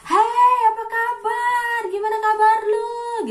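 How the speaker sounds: high, loud, drawn-out sing-song holler; shouted friendly greeting. Acted. Indonesian greeting a friend, shouted friendly greeting. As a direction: call out slowly across the street. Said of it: "orang Indonesia tuh kebanyakan ngomong itu".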